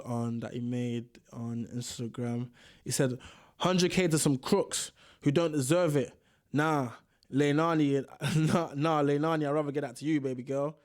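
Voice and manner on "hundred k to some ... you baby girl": put-on American accent. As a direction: put on a light American accent for his words. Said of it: "I'm doing that accent still".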